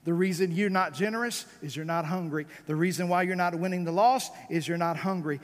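ordinary talk, nothing marked

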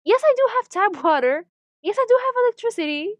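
loud, high-pitched sarcastic yes; exasperated insistence. Acted. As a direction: spell it out loudly for someone clueless. Exasperated answer to offensive questions.

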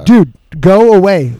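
loud, slow, drawn-out brush-off; exasperated dismissal. Retold or imitated. Cop to drunk neighbour during breathalyzer, exasperated dismissal. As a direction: stretch it out loudly as a fed-up cop.

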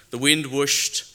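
loud, rushing storytelling. A narrator's reading. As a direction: let the wind rush through the line.

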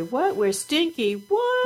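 rising, puzzled mock outrage ending on a high "What?"; mock surprise. Parent teasing child with mock surprise, high questioning. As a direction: play-act shock at being called stinky. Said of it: "And we say".

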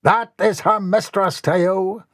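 high, drawn-out captain's cry; captain's indignant cry. Acted. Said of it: "cried Captain Mortimer".

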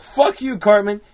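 loud, high quoted outburst; cartoon character retort. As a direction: snap it out loud, pitched well up. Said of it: "And the cow's like".

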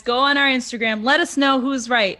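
ordinary talk

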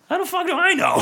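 very high, squeaky outburst; answering the regulars, high exasperated squeal. Retold or imitated. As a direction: throw up your hands in a squeak. Exasperated retelling to patrons.

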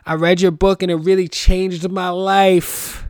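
high, loud, excited mock fan; grateful fan. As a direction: gush like a changed reader. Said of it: "they're gonna be like".

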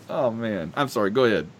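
normal talking voice

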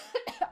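real coughing fit; rough chesty cough from smoky air. Real, not acted. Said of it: "I can't stop coughing".

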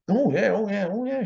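mock cackling laugh. Audience cackling at joke.

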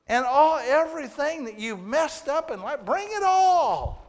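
high, loud, slow plea to come as you are; pleading call. Acted. Preacher calling the congregation to bring their sins, loud pleading. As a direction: reach out and beg them to bring it all. Pleading invitation at full force.

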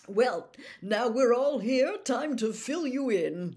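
loud, high, slow showman cheer; bright. A narrator's reading. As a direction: announce it brightly, drawing the words out. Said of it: "said Bagman brightly".

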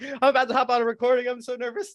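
high, quick, comically panicky; mock nervous. Texting his wife about hopping on, mock nervous. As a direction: rush it out in a hammed-up fluster.

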